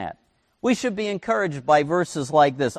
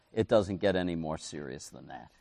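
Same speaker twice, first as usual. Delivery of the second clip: usual speaking voice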